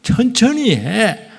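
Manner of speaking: slow, drawn-out self-instruction. Shown as a demonstration. Pastor coaching himself to preach slowly, said very slowly. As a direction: stretch each word as a reminder. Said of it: "말 빨리 하지 마".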